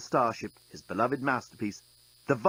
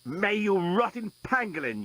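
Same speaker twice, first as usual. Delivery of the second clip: yelling; 7 semitones higher than usual. Acted. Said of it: "yelled the journalist".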